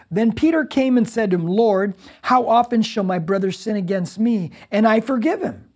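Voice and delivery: loud, high proclaiming read; scripture reading. Acted. Preacher reading the Bible aloud to his congregation. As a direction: read the verse out boldly to the hall.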